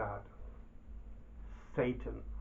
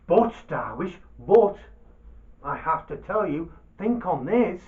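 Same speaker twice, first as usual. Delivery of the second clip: much louder, pushy boasting; blustering merchant; 2 semitones higher, 8 dB louder than usual. Acted. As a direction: bluster and brag to scare him. Baba Abdallah persuades loudly.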